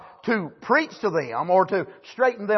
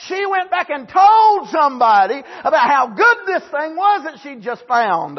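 shouted, higher preacher's cry; fiery preaching; 7 semitones higher, 4 dB louder than usual. Acted. Preacher shouting to the congregation, full force.

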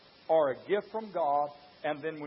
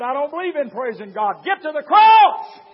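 preacher's shout; 10 semitones higher, 15 dB louder than usual. Acted. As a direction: bellow it to the back pews.

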